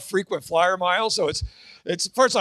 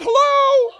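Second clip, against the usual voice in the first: much higher, louder "Hello?" with a laugh; ditzy blonde; 7 dB louder than usual. Acted. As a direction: squeak it like a clueless blonde. Blonde answers iron like phone.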